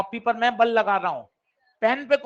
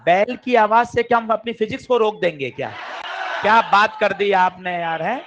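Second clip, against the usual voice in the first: loud mock protest; 5 dB louder than usual. Real, not acted. Teacher to class over the interrupting bell, loud mock protest. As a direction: protest loudly, half laughing.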